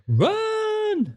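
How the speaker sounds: very high drawn-out yelp; long squealed warning, held on one high note; mock warning cry. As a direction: cry it out like a comic alarm. Jokey warning cry about the woman.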